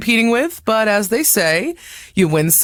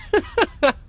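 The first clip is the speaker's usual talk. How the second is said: high light giggle after a joke; amused laugh; 10 semitones higher than usual. Real, not acted. Legend laughing at her niece's protest, light giggle. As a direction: laugh off the tease.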